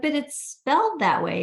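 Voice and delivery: high-pitched defensive reply; imitating puzzled protest. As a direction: act out a mock objection. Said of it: "And we're like".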